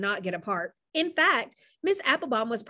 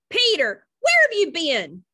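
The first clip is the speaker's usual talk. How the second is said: loud, higher call across the yard; worried brother calling out loudly; 8 semitones higher, 7 dB louder than usual. A narrator's reading. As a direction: shout for the missing brother.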